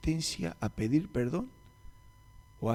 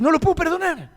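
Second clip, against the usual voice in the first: much higher, louder resentful woman's voice; bitter abandoned wife; 7 dB louder than usual. Retold or imitated. The separated sister rants to the pastor about her husband, bitter and high.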